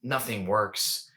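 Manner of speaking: normal talking voice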